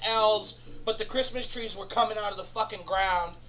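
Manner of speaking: ordinary talk, nothing marked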